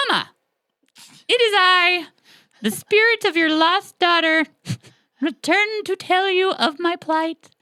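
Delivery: high, loud, eerie ghost voice; haunt her mother from the grave; spooky ghost daughter. Acted. Ghost daughter calling to her mother from the snake. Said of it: "It doesn't sound like that".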